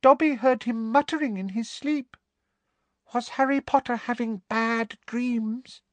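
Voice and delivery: very high, timid squeaky voice; timid squeaky house elf. A narrator's reading. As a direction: ask shyly like a worried little elf. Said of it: "looking timidly at Harry".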